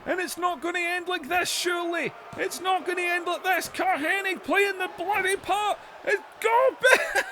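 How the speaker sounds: screaming at the finish; frantic play-by-play. Acted. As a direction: call the near-fall like it's the end of the world.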